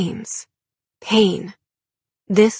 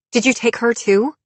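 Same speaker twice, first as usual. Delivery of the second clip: raised pitch, pressing question; accusing question; 6 semitones higher, 4 dB louder than usual. A narrator's reading. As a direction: demand an answer from a suspect. Heroine interrogates the cult member.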